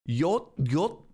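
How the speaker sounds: Yot with a y sound at the start; sounding out the Swedish start; imitating yot sound. Shown as a demonstration. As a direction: try out the clipped Yot syllable. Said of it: "that was like a yot sound".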